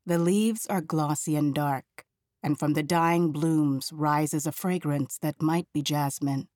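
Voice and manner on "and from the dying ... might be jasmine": usual speaking voice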